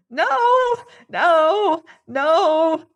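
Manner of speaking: very high, loud terrified shriek; cowering man's piercing no. Acted. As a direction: shriek in denial. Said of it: "shrieked Rake".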